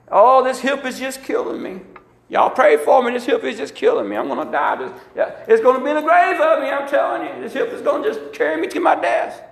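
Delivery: high, whiny mock moaning about the hip; mock complaining sufferer. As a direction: ham up a self-pitying complainer. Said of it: "You can't talk like that".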